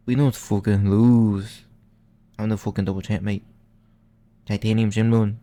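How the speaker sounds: loud, cocky Scouse accent impression; Paddy Pimblett Scouse impression. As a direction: shout it like a brash Liverpool fighter in a Scouse accent. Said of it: "he's gonna say".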